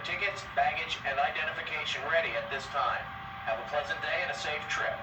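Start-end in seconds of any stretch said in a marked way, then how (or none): none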